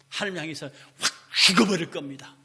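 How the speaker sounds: normal talking voice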